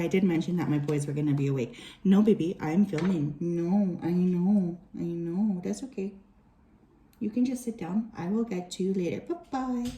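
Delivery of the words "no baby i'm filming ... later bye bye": hushing a pet. Real, not acted. Hushing dog while filming.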